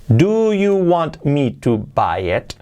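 slow "Do you want me to buy it?" with all the T's; every letter. Shown as a demonstration. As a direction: read it word by word, every sound. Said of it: "palabra por palabra con todas las letras". (palabra por palabra, con todas las letras)

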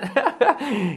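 high amused laugh. Real, not acted. Teacher amused by the pronunciation demo, laughing.

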